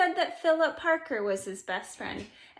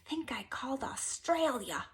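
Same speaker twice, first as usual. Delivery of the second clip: whispered, sheepish admission; a hushed guilty aside; 8 dB quieter than usual. A narrator's reading. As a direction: confess it under your breath. Sheepish confession of mischief.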